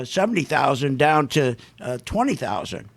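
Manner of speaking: ordinary talk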